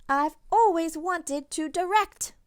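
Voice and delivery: high, loud, proud declaration; excited announcement. Acted. Girl announcing her dream to direct, high and loud. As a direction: announce it with glee. Girl's big realization.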